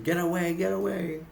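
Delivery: retold urgent warning. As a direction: mimic pushing someone away urgently. Retold father pushing daughter away.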